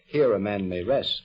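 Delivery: normal talking voice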